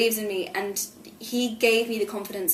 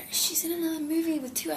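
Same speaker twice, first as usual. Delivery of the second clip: higher whispered gossip; mock gossip; 7 semitones higher, 4 dB quieter than usual. Actor imitating critics about her trios, higher gossipy whisper. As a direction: whisper it like a snide rumour. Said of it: "people have been like".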